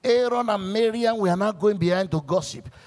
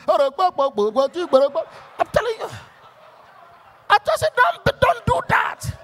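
mock shout; 10 semitones higher, 3 dB louder than usual. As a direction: wail it like a haunting voice. Imitating night noise warning.

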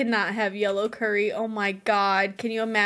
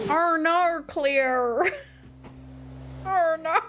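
high, hammed-up Aussie lament; Australian accent; 5 semitones higher, slower than usual. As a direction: exaggerate the Aussie vowels, pitched up. Mimicking the Australian catchphrase in chat.

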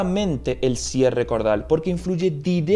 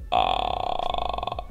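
low creaky fry on ah; crackly bubbling creak; vocal fry; 5 dB quieter than usual. Shown as a demonstration. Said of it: "se dice vocal fry o frito".

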